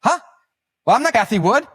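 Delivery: high, loud startled yelp; startled Scaramucci. Retold or imitated. Guest startled at wrong name, high loud yelp. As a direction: cry out in shock at being misnamed. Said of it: "And he goes".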